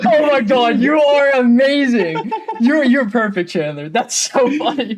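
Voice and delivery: praising a friend's soundbite, delighted; delighted praise. Real, not acted. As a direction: gush over it.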